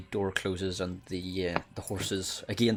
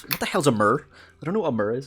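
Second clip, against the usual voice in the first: raised, louder mock bafflement; mock confused player; 6 semitones higher, 6 dB louder, much faster than usual. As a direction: play a baffled listener for laughs. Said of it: "They're like".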